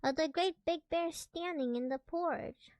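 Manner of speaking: plain reading voice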